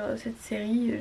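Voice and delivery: normal talking voice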